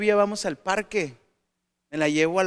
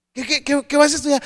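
questioning adult voice; 7 semitones higher, 7 dB louder, faster than usual. Retold or imitated. Adult quizzing indecisive student.

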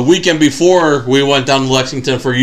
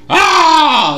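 excited shriek; 14 semitones higher, 3 dB louder than usual. Retold or imitated. How you would react to surprise.

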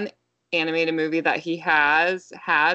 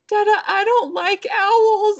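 put-on high, louder, slow little-girl whimper; toddler voice; 7 dB louder, slower than usual. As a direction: play a scared toddler on the verge of tears. Voicing toddler daughter about to cry.

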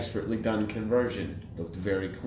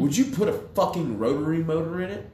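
1 outraged question; 8 semitones higher, 5 dB louder than usual. Real, not acted. Indignant rhetorical question to cohost.

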